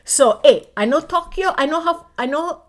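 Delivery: lively Hawaii Pidgin talk; Pidgin accent. Pretend. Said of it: "I gotta talk Pidgin a little bit".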